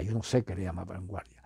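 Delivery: soft unvoiced aside; whispered aside. Real, not acted. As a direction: whisper it behind your hand. Muttered aside during the list.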